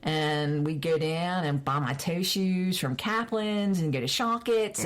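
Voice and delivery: homely Baltimore accent for reminiscing. Shown as a demonstration. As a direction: slip into a local Baltimore accent. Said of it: "I can pick it up like that".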